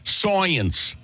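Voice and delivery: put-on one drawn-out word in old Brooklyn accent, high-pitched; Three Stooges Brooklyn accent. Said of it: "That is how the Stooges would say it".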